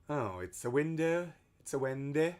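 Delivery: ordinary talk, nothing marked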